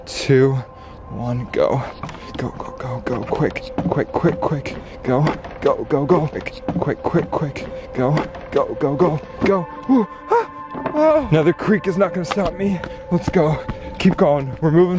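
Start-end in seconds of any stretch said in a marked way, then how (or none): none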